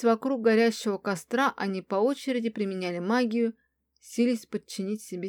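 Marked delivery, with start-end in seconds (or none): none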